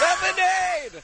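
high-pitched holler at a star overhead; shrieky mock fan call, drawn out; yelling fan. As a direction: yell it up to a passing plane, stretching the last word. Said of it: "that's not what you wanna yell".

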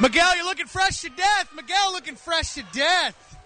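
hyped celebrity shout-out; excited hype. Real, not acted. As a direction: call out big and hyped. Hyping up a passing celebrity.

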